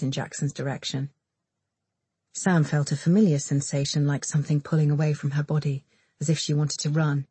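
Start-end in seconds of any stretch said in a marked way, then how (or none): none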